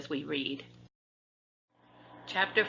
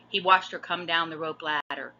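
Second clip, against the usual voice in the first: usual speaking voice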